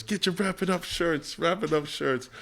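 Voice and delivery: softly imitated hawker's cry; street vendor call. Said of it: "I'm trying to sell my shirts".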